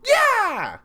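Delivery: high, loud hype yell; excited yell. Real, not acted. Hype reaction.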